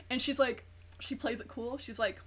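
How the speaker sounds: ordinary delivery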